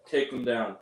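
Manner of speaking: low, half-hearted mock order; no conviction. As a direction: give the order as if you don't believe it. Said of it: "with no conviction".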